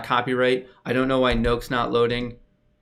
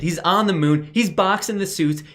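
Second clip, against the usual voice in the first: excited; 7 semitones higher than usual. Real, not acted. Gushing over fan art.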